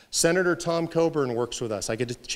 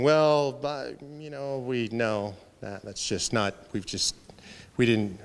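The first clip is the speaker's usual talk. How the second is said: embarrassed; 4 semitones lower, slower than usual. Acted. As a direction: fumble sheepishly for an answer, trailing away. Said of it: "the embarrassment of knowing that we've never done it".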